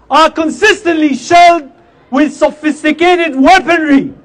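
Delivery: fiery protest speech; rally the crowd with force; loud soapbox ranting. Acted.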